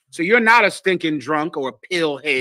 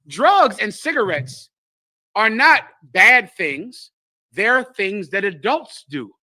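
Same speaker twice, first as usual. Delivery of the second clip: slow emphatic; slower than usual. Real, not acted. Preacher slows for emphasis.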